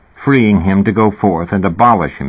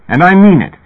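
loud, higher shout of admonition; yelling; 9 semitones higher, 5 dB louder than usual. A narrator's reading. Therapist yelling his warning at Fat, loud shout. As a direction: bark it at him, meaning every word.

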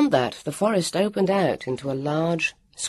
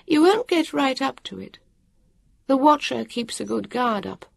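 a busy stranger explaining offhand; harassed woman of the future; 10 semitones higher than usual. A narrator's reading. Woman explaining to the children, brisk and higher.

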